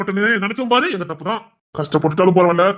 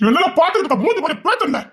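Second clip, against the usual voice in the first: high furious threat, pitch jumping up; angry threat; 8 semitones higher than usual. Acted. Brother threatening his defiant sister, furious high threat. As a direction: snap at her like you'll strike her.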